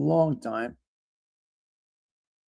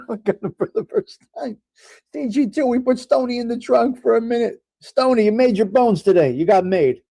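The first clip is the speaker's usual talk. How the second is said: higher, hammed-up gangster voice welcoming Stony to the trunk; mock mobster boss; 7 semitones higher than usual. Host putting chatter Stony in the trunk, hammed-up mobster. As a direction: play a gleeful mob boss dishing out punishment.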